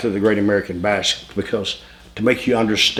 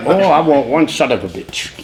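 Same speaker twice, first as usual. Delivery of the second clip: a hint of French Canadian accent, higher; lightly voiced French Canadian ordering food; imitating French Canadian accent; 4 semitones higher than usual. Ronnie ordering food in broken English, light French Canadian accent. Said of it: "Ronnie had that accent".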